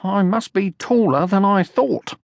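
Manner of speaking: sure of himself, voiced high; Sniff voice. A narrator's reading.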